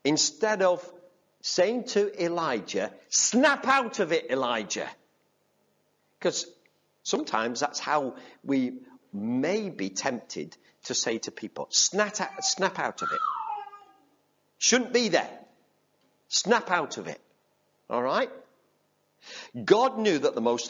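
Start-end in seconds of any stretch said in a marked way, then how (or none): 3.1-4.9 higher, sharp impatient scold; mock scolding. Preacher imitating a harsh rebuke to Elijah. What God did not say. 11.7-17.2 higher, impatient scolding; mock nagging. Preacher mocking what we tell struggling people.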